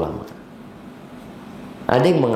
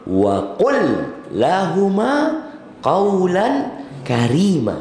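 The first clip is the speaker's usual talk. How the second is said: Quran recitation; 8 semitones higher, much slower than usual. Acted.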